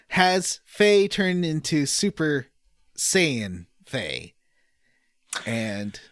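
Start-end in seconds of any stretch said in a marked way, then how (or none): 0.1-4.3 slow and deliberate, every word set apart; careful and correct. Shown as a demonstration. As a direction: spell the name out slowly so it comes out right. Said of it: "I'm gonna say it right".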